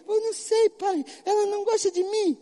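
put-on a spoiled boy complaining to his father, high and whiny; whiny child. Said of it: "ao menino".

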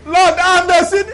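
high, hammed-up penitent cry; mock lament. Sinner insisting to the Lord on guilt, mock lament. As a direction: wail it upward to heaven in mock guilt.

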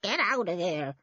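put-on croaky old woman's warning; croaking voice. A narrator's reading. Old woman ordering children off, croaking. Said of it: "a croaking voice behind them".

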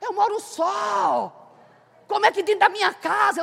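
slow, high, drawn-out shout; testifying to the congregation, slow high cry; fervent cry. Real, not acted. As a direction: cry it out, stretching every word. Preacher crying out her testimony.

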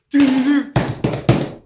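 high "doo" sound effect; imitated noise. Showing what he heard from his cell, imitated noise. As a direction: voice the noise in high little bursts.

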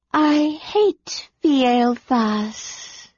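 slow, drowsy dragon voice; sleepy dragon mutter. A narrator's reading. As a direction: mutter it like a half-asleep dragon. Sleepy dragon answers Kit.

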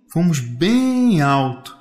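BEM drawn out long and rising in pitch; slow, wondering stretch on bem; stretched emphasis. Acted. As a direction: stretch bem out to show great height. Child stresses how high they flew.

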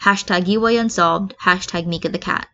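ordinary delivery, nothing marked

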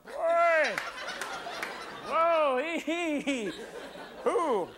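high, giggling animal voice; cackling animal. Retold or imitated. The animals mocking the coat's owner, high and giggling. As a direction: laugh at the coat like a smug critter. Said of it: "the animals will be going".

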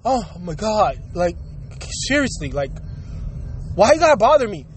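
low, sulky bored clerk voice; annoyed clerk. Retold or imitated. Imitating a rude store clerk bothered by a customer. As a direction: sigh it out like a put-upon worker. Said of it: "you gotta look at me like".

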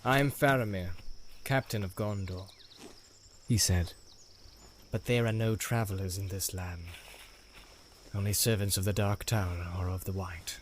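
a hint of a measured, stern leader; Faramir, stern captain. Faramir voiced in read story.

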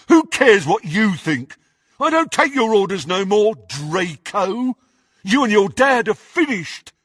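high, loud angry defiance; undisguised ferocity. A narrator's reading. Crabbe rejects Draco's orders, ferocious. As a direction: turn on your leader fiercely. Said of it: "Crabbe turned on Malfoy with undisguised ferocity".

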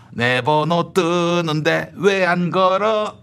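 ordinary talk, nothing marked

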